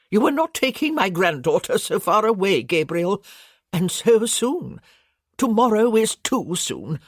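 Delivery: put-on plaintive old countess begging him to stay; grandmother voiced. Grandmother laments departure.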